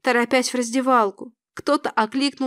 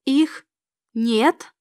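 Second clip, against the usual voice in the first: halting, stunned question; understated horror, broken by a pause; horrified echo. A narrator's reading. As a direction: repeat it in disbelief, word by word. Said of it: "Я в ужасе уставилась".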